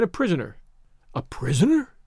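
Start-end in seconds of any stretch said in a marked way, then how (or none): 1.1-1.9 questioning echo with a rising end; incredulous. A narrator's reading. Tarzan echoing the girl's word, incredulous. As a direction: repeat it in mild disbelief.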